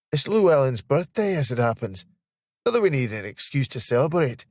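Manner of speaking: cheery Scottish banter; playful Scottish colleague. Acted. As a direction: share party news in broad Scots. Voices Steve, Scottish colleague.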